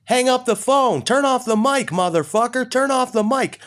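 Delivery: high, loud shouting at the phone; yelling into the phone. Retold or imitated. Yelling at the stranger on his phone mic, loud. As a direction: yell it at the eavesdropper on the line. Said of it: "I start yelling into the phone".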